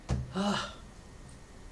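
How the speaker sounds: long breathy sigh; weary sigh. Acted. Storyteller marking the week with the goat, weary sigh. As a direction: let out a long, acted sigh.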